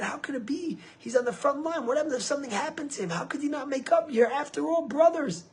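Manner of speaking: high, urgent retold plea; impassioned appeal. Retold plea to estranged brother.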